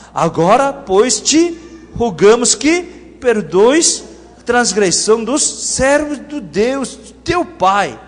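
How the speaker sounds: loud, slow, measured verse reading; projected, deliberate Bible reading to the congregation; scripture reading. Acted. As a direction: proclaim the plea as scripture. Reads verse seventeen aloud.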